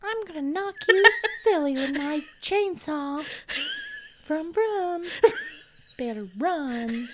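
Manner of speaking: high falsetto threat, laughing through it; dainty squeaky killer voice; Leatherface. Pretend. Podcaster voicing the killer's chainsaw threat, high-pitched Leatherface. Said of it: "But in that voice".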